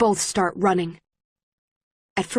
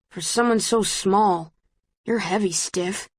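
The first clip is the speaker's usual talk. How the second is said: muttered. A narrator's reading. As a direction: grumble it half under breath. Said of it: "he mutters".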